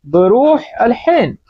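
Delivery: बरूह अल हिन spoken slowly with a throaty ḥ; Arabic ḥ sound. Shown as a demonstration. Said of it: "हा की आवाज निकालेंगे".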